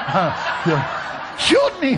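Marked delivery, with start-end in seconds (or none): 1.4-2.0 very high, loud comic shriek; imitated lady. Preacher mimics lady jumping up, shrieked. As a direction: squeal it out as an over-eager lady. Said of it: "some lady jumps up and goes".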